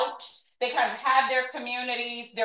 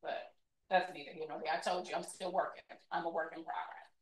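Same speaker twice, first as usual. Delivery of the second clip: low, soft, quick aside; muttered aside; 6 semitones lower, 13 dB quieter, faster than usual. Real, not acted. Lecturer admitting she's still working on forgiveness, muttered aside. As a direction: drop it under your breath, fast.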